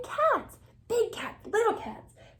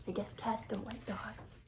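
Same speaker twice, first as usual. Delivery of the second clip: whispered aside; 6 semitones lower, 12 dB quieter than usual. Acted. Aside to the listening child.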